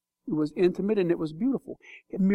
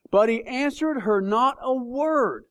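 slow reading, each word weighed; slow, weighty reading; 4 semitones higher, slower than usual. Acted. Preacher reading the silent answer, slow and weighty. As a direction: let the silence of Jesus land slowly.

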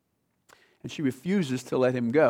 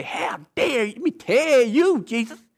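mock indignant outburst, high and loud; acted outrage, pitch shooting up; mad; 8 semitones higher, 4 dB louder than usual. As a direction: put on an offended woman's high-pitched rant. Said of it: "She doesn't get mad and say".

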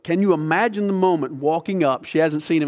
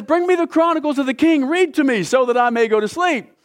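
much higher, louder mock-royal command; bored king; 10 semitones higher, 3 dB louder than usual. Sleepless king ordering the records read, mock-royal high voice. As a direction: call for a boring read to sleep. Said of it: "So he says".

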